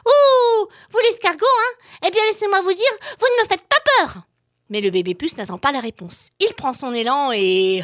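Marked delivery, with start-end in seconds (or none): 0.0-4.2 high, loud cheeky little voice; a brave tiny flea, defiant; baby flea voice. Acted. Baby flea defies the snail.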